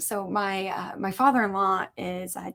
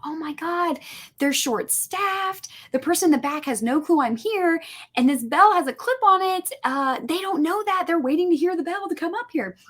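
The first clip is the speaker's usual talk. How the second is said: panicked inner thought; 6 semitones higher than usual. Retold or imitated. Panicking inner thought at DMV counter.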